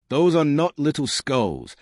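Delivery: angry. A narrator's reading. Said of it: "the commander said angrily".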